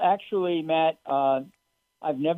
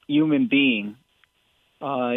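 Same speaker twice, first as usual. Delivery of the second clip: usual speaking voice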